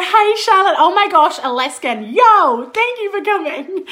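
high, loud hello to arriving friends; gushing, high-pitched welcome; excited greeting. Real, not acted. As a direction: call out delightedly as each one turns up. Greets viewers arriving on the live.

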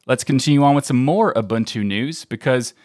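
usual speaking voice

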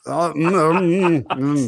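talking around a thermometer; mumbling with a thermometer in mouth; mouth full. Real, not acted. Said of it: "And now you got a mouthful".

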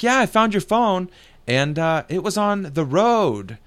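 high-pitched imitation of a Russian-accented lady; imitated foreign accent. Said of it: "She's got a real strong accent".

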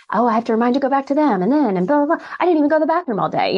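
fast, high, breathless rush of a hectic day; frantic nurse racing the clock; rushed retelling. Retold or imitated. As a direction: rattle it off like you're chasing patients. Retold hectic workday.

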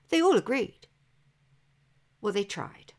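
usual speaking voice

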